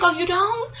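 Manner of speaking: high, soft mock innocence; feigned innocence. Friend answering her doubts about the food, feigned innocence. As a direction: imitate the friend in a small high voice.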